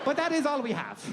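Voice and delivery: high, accented mock lament; comic foreign-accented sign-off; Hippocrates. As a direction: concede it theatrically as an ancient physician. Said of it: "Hippocrates out".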